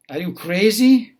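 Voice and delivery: loud, high mock disbelief; incredulous. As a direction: bark it in disbelief at the teacher. Boss reacts to exam offer.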